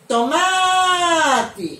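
TOMATE called high and loud, MA stretched long; shouted word. Shown as a demonstration. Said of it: "É só eu gritar a palavra". (grito agudo de "Tomate", alongando o MA)

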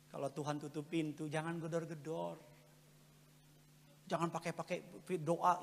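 ordinary talk

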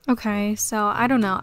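normal talking voice

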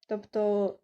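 usual speaking voice

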